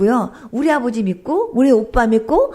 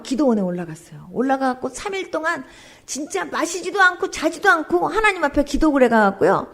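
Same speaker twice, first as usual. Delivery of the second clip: ordinary talk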